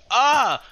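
high, loud sudden scream; startled scream. Real, not acted. Gamer ambushed by a mob, startled scream. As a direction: yelp in fright. Attacked in game.

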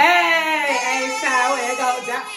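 loud, high hype call; excited shout. Real, not acted. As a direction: hype her up like a cheer. Hyped greeting of Houston.

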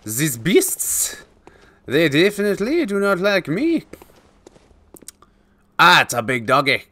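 a hint of Scottish at a big dog; Scottish accent. Spotting the big dog, mild Scottish accent. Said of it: "Why am I trying to be Scottish?".

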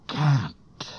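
high, strained plea of a freezing man; exhausted, high and strained; weak, failing voice. A narrator's reading. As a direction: barely get the words out. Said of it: "he said".

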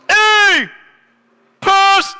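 very high, loud hail across a mall; excited yell. Retold or imitated. Stranger spotting the pastor in the mall, yelling. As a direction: holler it out, stretched and high-pitched. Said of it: "and somebody yells".

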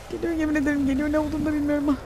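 fast high anxious gabble; frightened gamer; scared rush. Real, not acted. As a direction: rush the words in fear. Scared rushing words.

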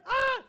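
high, loud scream of alarm; startled yell. Real, not acted. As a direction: yelp as if jumped by the killer. Startled by suspected killer in game.

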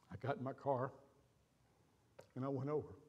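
normal talking voice

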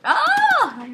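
high, loud "ah"; startled scream. Real, not acted. Player startled by possible murderer, high scream.

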